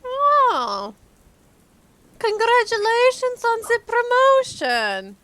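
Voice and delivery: high, bright mock cheer; excited congratulations. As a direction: cheer him on, half teasing. Cheeky reply to Vladimir.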